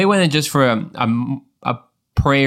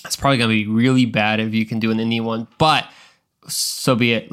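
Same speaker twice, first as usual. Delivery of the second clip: ordinary delivery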